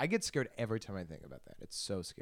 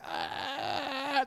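much higher, put-on porn moan; mock high moan. Mocking the guy in the porn video, a high moan. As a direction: imitate the moan as it climbs up high. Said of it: "And then it just gets higher".

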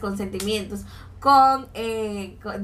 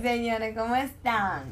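usual speaking voice

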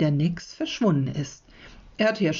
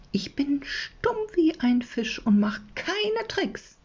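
high, slow whispered sprite voice; airy whisper of a tiny nix; 9 semitones higher, slower than usual. A narrator's reading. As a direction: breathe it like a secretive little water creature. The water sprite promises silence.

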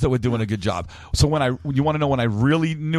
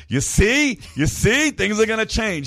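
smug told-you-so; 9 semitones higher, slower than usual. Retold or imitated. Telling his kids told you.

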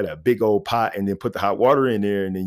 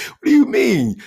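mock indignant relative; 6 dB louder than usual. Relative's indignant reply, high.

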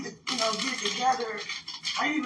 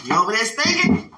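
teasing; 2 semitones higher, 11 dB louder than usual. Pretend. Teasing, laughing.

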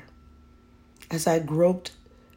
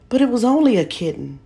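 put-on high, fast, louder child's protest; pleading boy; 8 semitones higher, 7 dB louder, faster than usual. A narrator's reading. Boy explaining to mother, high and rushed. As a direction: rush the excuse out. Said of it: "I explained".

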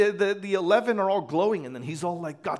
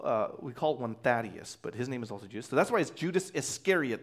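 ordinary talk, nothing marked

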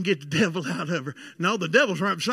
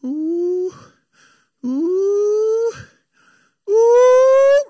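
imitated siren shout, climbing higher and louder; mother's rising siren whoop, mimicked; winding-up siren wail; 11 semitones higher, 11 dB louder, much slower than usual. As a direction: wail "ooh" like a siren cranking up. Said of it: "We call her the siren".

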